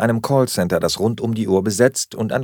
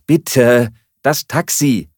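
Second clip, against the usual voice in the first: higher, insistent reminder; urgent plea; 3 semitones higher, 5 dB louder, slower than usual. A narrator's reading. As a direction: press her to get moving. Urges flatmate to order taxi. (drängend gebeten)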